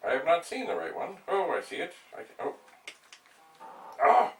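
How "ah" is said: ordinary talk, nothing marked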